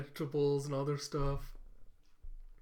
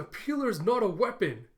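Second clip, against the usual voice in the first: exasperated; 6 semitones higher, 6 dB louder than usual. Acted. Camilla corrects Yor.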